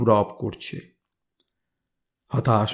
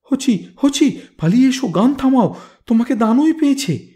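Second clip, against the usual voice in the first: much higher, louder and fast panicked cry; frantic calling; 13 semitones higher, 5 dB louder, faster than usual. Acted. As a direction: shout to drag him away from danger, fast. Servants call Hoichi in panic. (আতঙ্কিত চিৎকার করে ডাকা)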